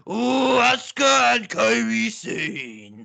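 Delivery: high, slow shouted put-on accent; Japanese accent impression. Mimicking an announcer with accent.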